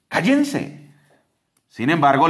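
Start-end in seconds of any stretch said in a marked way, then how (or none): 0.1-0.9 much higher scolding shout; crowd shouting. Retold or imitated. The crowd shouts down the blind men, high scolding. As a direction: order them silent, pitched way up. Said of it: "le gritó la multitud".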